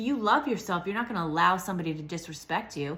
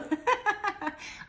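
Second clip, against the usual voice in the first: laugh. Real, not acted. Laughing after thanks.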